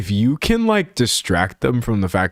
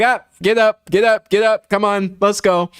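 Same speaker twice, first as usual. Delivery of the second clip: put-on hustle the kid back up like nothing happened; brisk get up; 9 semitones higher than usual. Shown as a demonstration. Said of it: "if you're just like".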